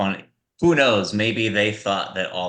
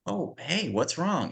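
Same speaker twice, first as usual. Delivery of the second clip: softer, caring what's wrong; soothing a crying girl; tender, quiet check-in; gentle concern; 7 semitones higher, 5 dB quieter, slower than usual. Retold or imitated. Actress gently asks crying girl.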